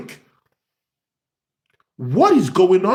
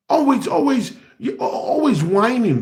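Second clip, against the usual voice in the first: mock whining; 7 semitones higher than usual. Mocking Declan Rice's whining.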